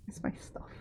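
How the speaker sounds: hushed whisper to herself. Real, not acted. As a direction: whisper the question. Searching for lost items.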